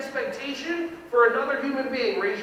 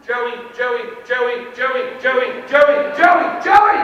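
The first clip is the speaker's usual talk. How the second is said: mock teacher calling Joey louder each time; building call to shout; 10 dB louder, much slower than usual. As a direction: start calling, end shouting. Teacher calling a child repeatedly.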